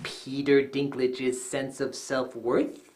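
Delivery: very low, slow, near-murmur; mock hushed awe. Reviewer tops a list of macho men, in mock-reverent hush. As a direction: drop it deep and hushed, drawn right out.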